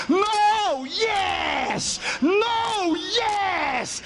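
high, long-held opposing cries; acted shouting match. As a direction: trade stubborn shouts, high and drawn out. Acted shouted back-and-forth on plane.

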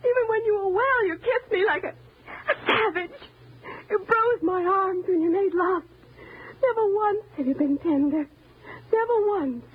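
high, tearful reproach; long-held hurt pouring out, high and strained; anguished accusation. Acted. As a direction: accuse him through tears. Accuses husband of years of cruelty.

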